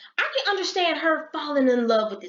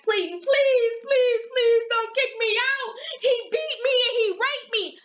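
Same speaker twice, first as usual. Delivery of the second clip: desperate begging; 10 semitones higher than usual. Retold or imitated. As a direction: plead frantically, voice up high. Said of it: "Halle Berry is like".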